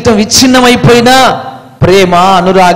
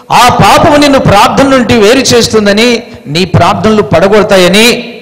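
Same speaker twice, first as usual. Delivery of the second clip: ordinary talk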